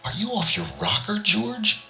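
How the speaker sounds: high, suspicious woman's voice. A narrator's reading. Lil questions George's sanity, high and suspicious. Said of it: "she asked suspiciously".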